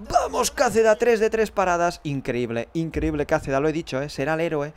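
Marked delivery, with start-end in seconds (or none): 0.1-2.0 high, loud cheer for the keeper; excited fan cheering; jubilant shout. Real, not acted. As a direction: yell it out in triumph. Cheering the keeper's third save.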